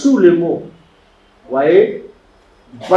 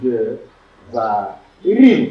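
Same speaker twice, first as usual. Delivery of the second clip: very slow "Devarim", split into de, va, rim; "Devarim" broken up slowly, syllable by syllable; syllable-by-syllable demonstration; 4 semitones lower, much slower than usual. Shown as a demonstration. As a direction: sound out "Devarim" for students, a pause after each syllable. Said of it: "il faut toujours accentuer l'avant-dernière".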